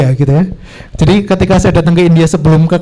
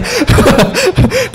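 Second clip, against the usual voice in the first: a high giggle at own bragging; high laugh; 12 semitones higher than usual. Real, not acted. Vlogger laughing at his own boast, high giggle. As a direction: laugh at yourself.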